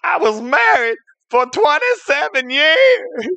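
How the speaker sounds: slow, high-pitched wail; hammed-up lament of a jilted husband; anguished lament. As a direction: wail it out slowly, heartbroken. Dumped husband lamenting his marriage.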